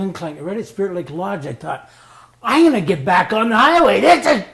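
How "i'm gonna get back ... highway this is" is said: high and loud, building thrill; excited rush. Retold or imitated. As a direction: blurt it as the bike climbs out. Retelling bike climbing back up.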